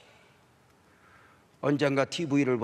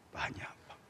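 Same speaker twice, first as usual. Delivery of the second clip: faint whisper; 11 dB quieter than usual. Retold or imitated. Sick wife answering weakly.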